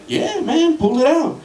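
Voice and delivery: high, laughing eager yes; a hint of an excited buddy; eager stoner. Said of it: "I'm like".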